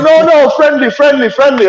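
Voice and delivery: high, insistent mock pleading; imitated placating protest. Man placates the rider he angered, high insistent imitation. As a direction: imitate him protesting, pitched right up.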